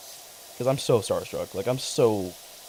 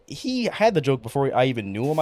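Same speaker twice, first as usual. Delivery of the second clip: usual speaking voice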